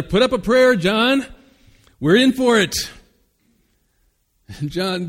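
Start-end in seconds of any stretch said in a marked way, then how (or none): 0.0-2.7 high, loud panicked yell; terrified shout. Acted. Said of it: "the one shouted out to the other".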